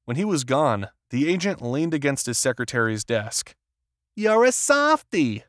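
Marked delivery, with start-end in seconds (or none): 4.2-5.4 slow, fond, high-voiced teasing; affectionately. A narrator's reading. Secretary teasing the agent with affection. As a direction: tease him gently with affection. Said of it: "she said affectionately".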